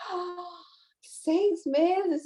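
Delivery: a light retold gasp of disbelief, "seis meses?" rising in wonder; mock astonishment at the baby's age; astonished. (espanto leve, incrédulo)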